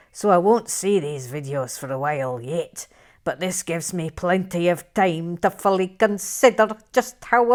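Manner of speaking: put-on Scottish accent, slowing at the end. Shown as a demonstration. Host rereading a viewer's comment, Scottish accent. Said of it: "read that in a Scottish accent".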